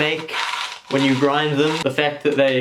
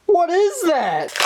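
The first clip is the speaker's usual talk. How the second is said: high incredulous; 13 semitones higher, much slower than usual. Real, not acted. Confused at packaging.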